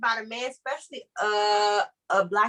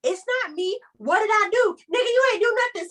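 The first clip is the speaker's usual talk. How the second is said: high-pitched mock defensive man; defensive boyfriend; 9 semitones higher, much faster than usual. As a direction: whine it like a guy who thinks he's being blamed. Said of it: "that frustrates us more when you're like".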